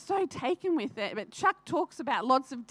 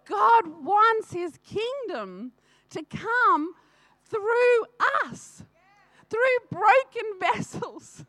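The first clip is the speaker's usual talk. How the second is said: higher, louder, slow, voice wavering with feeling; emotional; 8 semitones higher, 6 dB louder, much slower than usual. Real, not acted. Telling the church of broken vessels, emotional and slow. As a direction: press each word out, deeply moved.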